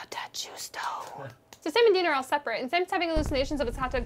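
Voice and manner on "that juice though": slow, voiceless whisper; craving whisper. Acted. Sam catching a whiff of demon blood, craving whisper. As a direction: whisper it slowly after a sniff.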